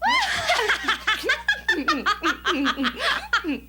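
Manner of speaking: nervous, sheepish giggle; embarrassed laugh. Acted. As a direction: laugh it off, flustered. Embarrassed after daydream dance.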